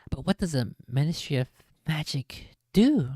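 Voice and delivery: plain reading voice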